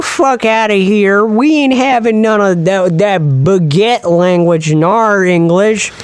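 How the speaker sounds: hammed-up American twang; mock American voice. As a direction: play a defiant American yokel. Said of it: "the Americans were like".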